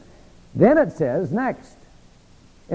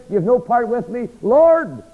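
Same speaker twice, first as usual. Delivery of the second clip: higher, louder, hammed-up alarm; mock alarmed plea; 8 semitones higher, 4 dB louder, slower than usual. Peter panicking after the Lord's warning, hammed-up alarmed cry.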